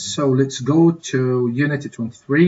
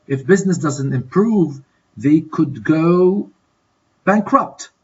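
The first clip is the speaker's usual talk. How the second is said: slow fill-in-the-blank reading; 2 semitones higher than usual. Shown as a demonstration. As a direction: stretch the lead-up and leave a gap before the answer. Teacher fills in exercise blank.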